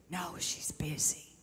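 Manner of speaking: put-on slow, hushed child whisper. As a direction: whisper it slowly, like a secret. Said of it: "He whispered".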